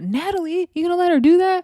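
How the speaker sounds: high, loud call across the room; mock shout at a rival to stir things up. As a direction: egg her on with a loud challenge. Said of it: "she called".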